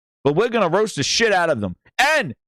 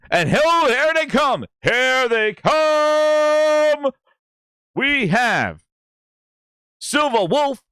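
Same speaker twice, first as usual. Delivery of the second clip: hype announcement; 7 semitones higher, much slower than usual. Real, not acted. Host hyping viewers as the stream starts, stretched high call. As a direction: drag out here they come like an arena announcer.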